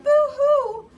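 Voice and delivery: loud, high hammed-up boo-hoo; rat crying. Reading to child, the scared rat cries loudly. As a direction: wail it like a crying cartoon rat. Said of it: "who cried".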